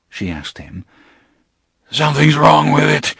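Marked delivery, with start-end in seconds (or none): 1.9-3.1 gasping. Acted. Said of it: "he gasped".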